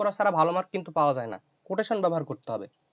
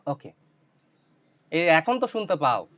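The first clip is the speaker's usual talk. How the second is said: ordinary talk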